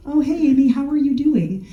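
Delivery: high, bright sing-song greeting; mock cheerful dad. Imitating the dad calling, sweet and high. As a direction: pitch way up, playing the friendly caller. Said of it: "it wasn't like".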